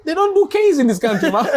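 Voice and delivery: high-pitched, amused protest; exasperated protest. Retold or imitated. Child explaining school rules to mom, exasperated. Protesting to mother in retold argument.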